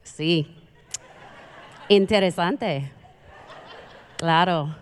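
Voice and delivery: low, hesitant Spanish with a laugh; awkward fake Spanish. Speaker bluffing through her full Spanish, awkward and hesitant. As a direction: bluff along with halting Spanish. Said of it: "and I was like".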